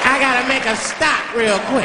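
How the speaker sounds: high, slow put-on voice; limousine driver. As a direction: announce it slowly in the driver's high voice. Driver announces a detour after call.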